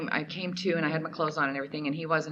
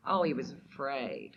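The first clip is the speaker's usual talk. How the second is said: sad; 3 semitones lower, 4 dB quieter than usual. Real, not acted. Painful realization.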